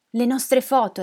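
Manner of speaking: insistent repetition. Acted. Old woman repeating her request to the neighbours, insistent. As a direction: press the request with feeling.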